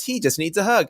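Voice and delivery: a gentle sing-song plea; imitating sweet wife. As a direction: make her sound sweetly naive. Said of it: "And she goes".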